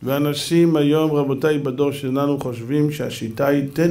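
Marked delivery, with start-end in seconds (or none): none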